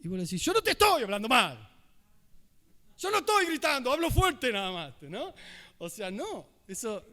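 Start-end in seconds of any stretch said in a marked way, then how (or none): 0.4-4.9 louder, pushing back that you're not shouting; defensive loud reply. Retold or imitated. Arguing back to someone who complained, mock-defensive and loud. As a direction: insist you're not yelling while half yelling.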